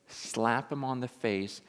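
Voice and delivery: ordinary talk, nothing marked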